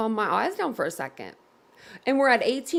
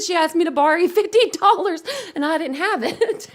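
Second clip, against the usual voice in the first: high, louder, breaking into laughter; laughing while retelling; 7 semitones higher, 4 dB louder than usual. Real, not acted. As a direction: retell it barely holding the laugh in. Retelling the clip, cracking up.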